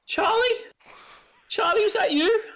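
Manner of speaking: high, loud calling woman's voice. Acted. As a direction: call out for Charlie in a woman's high voice. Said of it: "a woman's voice going".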